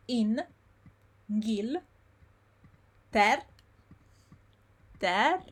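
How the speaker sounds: in, gil, ter, ra pulled apart with pauses; slow syllables. Shown as a demonstration. Teacher slowing the word down for learners. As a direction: break the word into slow beats. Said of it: "pronounce it slowly first".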